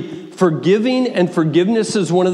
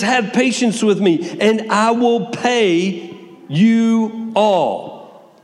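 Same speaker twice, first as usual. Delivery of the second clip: slow, drawn-out pleading, a touch higher; pleading servant; 4 semitones higher, much slower than usual. Acted. Servant begging his master for patience, drawn-out pleading. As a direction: beg the master for time, slowly. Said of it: "He's pleading with him".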